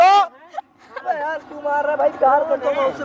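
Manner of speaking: high, dragged-out protest; high pleading protest. Acted. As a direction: cry out in protest. Protesting while being beaten. (ऊँची आवाज़ में गिड़गिड़ाना)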